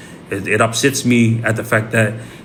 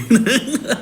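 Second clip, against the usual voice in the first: short rising chuckle; amused high giggle; high laugh; 12 semitones higher than usual. Real, not acted. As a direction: laugh at your own joke. Laughs at own joke.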